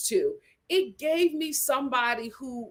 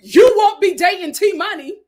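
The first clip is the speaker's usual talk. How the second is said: louder, higher you won't be dating T Money; loud incredulous correction; 7 semitones higher, 10 dB louder than usual. Real, not acted. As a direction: exclaim it in disbelief. Laughing at Deontay.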